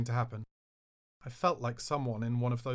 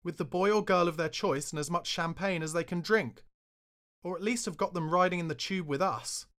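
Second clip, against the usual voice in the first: sarcastic thunder; 6 semitones higher than usual. Retold or imitated. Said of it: "I thundered".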